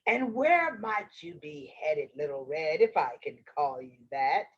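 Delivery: low, slippery wolf voice; sly wolf. Acted. Wolf flatters the child he wants to eat, low sly voice. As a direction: flatter her in a low voice, catching the slip.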